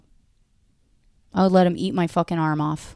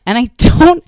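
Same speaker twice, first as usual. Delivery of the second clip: normal talking voice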